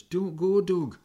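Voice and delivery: lightly acted plea, pitched high; Jim. Army pal begging his friend to stay, mildly acted pleading. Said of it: "Jim's pleading words".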